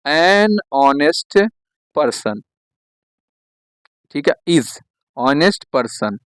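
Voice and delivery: stretched, word by word; slow dictation. Shown as a demonstration. Teacher dictates the corrected phrase to students, slowly. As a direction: drag it out for the class to copy. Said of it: "क्या हो जाएगा बेटा?".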